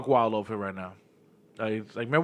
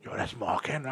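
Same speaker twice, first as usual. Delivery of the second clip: awed whisper; 11 dB quieter than usual. Retold or imitated. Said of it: "I was bugging out".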